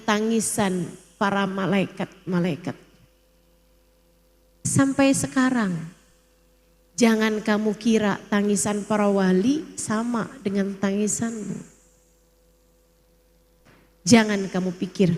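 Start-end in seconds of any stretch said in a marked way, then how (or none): none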